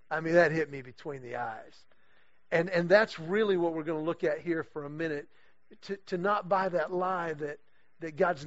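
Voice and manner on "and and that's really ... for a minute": usual speaking voice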